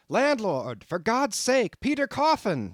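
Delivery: crying out for the landlord in the dark; terrified shout. A narrator's reading. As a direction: scream for help in terror. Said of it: "shouted I".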